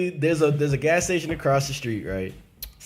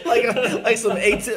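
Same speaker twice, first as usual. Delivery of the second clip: talking through a laugh; 8 semitones higher, 4 dB louder than usual. Real, not acted. Friend laughing while telling the story. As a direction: laugh it out, high and loud.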